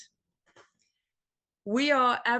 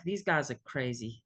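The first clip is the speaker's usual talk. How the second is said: mock thought, dropped low and quieter; low wary aside; 9 semitones lower, 4 dB quieter than usual. Newcomer recalling first thoughts of the group, low mock aside. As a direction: say it low, as a privately judging newcomer. Acting out early baffled thoughts.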